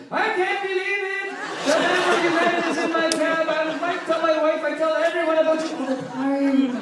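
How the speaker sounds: a starstruck fan exploding with excitement; a cabbie who can't believe who is in his cab, shrieking with delight; overjoyed shout. Retold or imitated. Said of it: "He's like".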